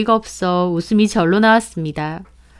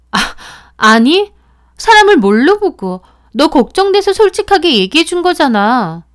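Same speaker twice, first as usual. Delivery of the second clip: higher, louder indignant snap; angry outburst; 7 semitones higher, 6 dB louder than usual. Acted. Friend accused of meddling, angry indignant outburst. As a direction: bristle as if insulted. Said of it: "소희는 화까지 내더라고요".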